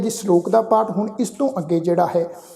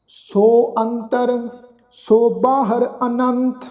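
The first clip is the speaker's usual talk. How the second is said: slow, measured recitation of sacred verse; slow scripture recitation; 3 semitones higher, much slower than usual. Acted. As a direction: lay each phrase down slowly with pauses. Recites gurbani verse slowly.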